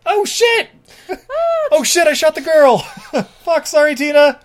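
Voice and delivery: high, loud frantic apology; panicked cop. Retold or imitated. Cop who shot the girl, frantic high yelp. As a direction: blurt it in horrified panic. Cop accidentally shoots Tina.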